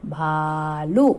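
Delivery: very slow, भालू with the ऊ stretched long; long drawn ऊ. Shown as a demonstration. Teacher showing the long vowel to children, slow. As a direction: draw out the ऊ in भालू. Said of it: "बड़े ऊ की मात्रा".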